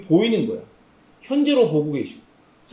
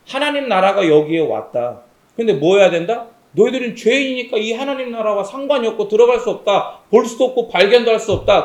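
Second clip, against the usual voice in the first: full-force preaching; 2 semitones higher, 8 dB louder than usual. Acted. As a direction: proclaim it to the congregation, building in force. Preaching on God's kingdom.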